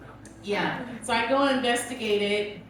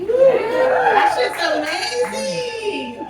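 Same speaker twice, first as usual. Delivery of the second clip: very high, bursting with delight; ecstatic rave; 12 semitones higher, 5 dB louder, much slower than usual. Real, not acted. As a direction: gush about it like it changed your life. Raving about bidet.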